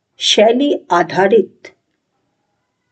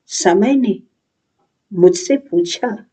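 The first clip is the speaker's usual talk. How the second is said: lowered repeat. Acted. Thoughtful youth echoes time's question to himself, lowered delivery. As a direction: let the line settle and drop.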